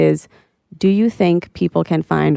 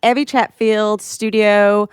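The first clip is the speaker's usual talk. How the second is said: a hammed-up, higher Australian accent; mock Australian accent, pitched up; terrible Australian accent; 5 semitones higher than usual. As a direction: greet them like a bad Aussie host. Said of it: "Put on your Australian accent".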